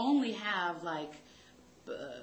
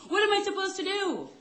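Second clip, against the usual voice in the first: higher, louder, frantic mother voice; panicked mom; 9 semitones higher, 9 dB louder than usual. Retold or imitated. Mother panicking over cooking for ex-husband, high and loud. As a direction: wail it like a flustered mom. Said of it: "she said".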